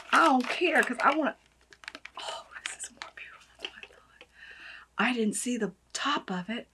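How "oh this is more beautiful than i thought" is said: breathless whisper of wonder; hushed amazement; awed whisper. Real, not acted. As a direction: whisper it in awe. Whispered awe at bottle.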